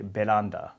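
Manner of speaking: "Veranda" with an R that sounds like L, be-lan-da; Japanese-accented veranda. Retold or imitated. Parents naming the balcony "Veranda", be-lan-da. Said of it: "they would say".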